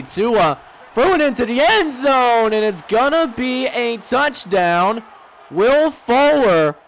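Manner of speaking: excited. Real, not acted. Opponent scores touchdown.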